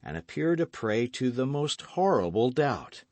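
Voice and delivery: ordinary delivery, nothing marked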